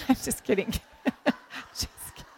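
real giggling through the words; helpless, breathy laughter taking over; laughing. Real, not acted. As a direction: barely get it out for laughing. Laughs at own joke about husband.

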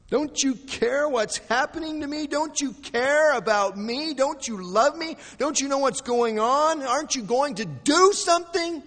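high, loud, desperate pleading; crying out. Acted. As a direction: cry out question after question. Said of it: "And we cry out".

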